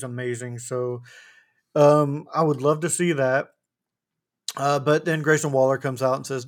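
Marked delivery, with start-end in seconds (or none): none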